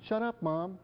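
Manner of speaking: put-on high-pitched teen backtalk; defiant adolescent. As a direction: imitate a kid mouthing off at mom. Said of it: "suddenly turns and goes".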